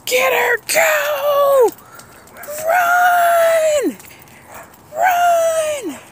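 very high, loud shouts. Real, not acted. Urging everyone to flee, very high and loud. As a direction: yell at them to run.

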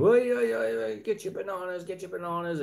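high shouted market call; street vendor shout. Retold or imitated. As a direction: holler like a fruit seller. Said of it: "a veg guy out there shouting".

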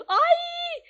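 sudden high loud cry; surprised cry. Real, not acted. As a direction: yelp in surprise. Surprised by item in inventory.

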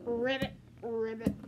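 low, hammed-up frog croak; a child's pretend frog; croaky frog voice. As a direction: play the frog, croaking ribbit.

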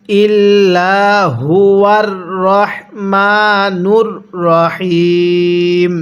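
slow recitation, রহিম drawn out long; Quran recitation. Shown as a demonstration. Teacher reciting for viewers to copy, slow drawn-out recitation. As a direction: recite ইল্লাহু ওয়ার রহমানুর রহিম slowly, stretching রহিম. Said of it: "কিভাবে পড়ব?".

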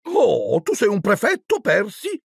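high, amazed question; exaggerated wonder, pitched high; feigned great surprise. A narrator's reading. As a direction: open on a big astonished "oh". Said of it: "con aria di grande sorpresa".

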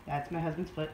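normal talking voice

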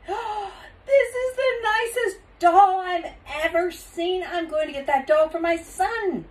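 high, loud delighted gushing; gasp, then gush high and loud; gushing customer. A narrator's reading. Man finds a doll for his son, gushing excitedly.

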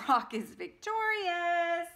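loud, high, slow victory call; grand ring announcer. Acted. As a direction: proclaim the winner slowly. Announcing the winner.

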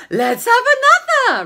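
high, loud excited suggestion; hammed-up eager dad; eager man. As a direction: pitch it up, thrilled.

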